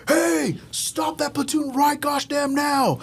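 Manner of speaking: high, slow mock shout of a first sergeant; imitating a first sergeant's shout. First sergeant stops a platoon that is not his, hammed-up shout. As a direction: bark the order to halt, dragging it out.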